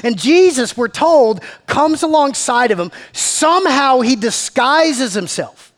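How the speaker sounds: loud, high, driving sermon voice; preaching at full force. Acted. Pastor retelling Emmaus to his congregation, preaching at full force. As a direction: preach it out to the whole room.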